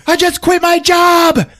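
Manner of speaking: high, loud, drawn-out outrage; hammed-up girlfriend shouting; mock furious yell. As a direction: wail it slowly at full volume. Acted quarrel, girlfriend yells.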